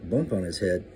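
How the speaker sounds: plain reading voice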